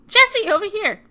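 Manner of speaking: high, loud hail; calling out. Acted. Player calling Jesse over, high loud call. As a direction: holler to a friend across the way.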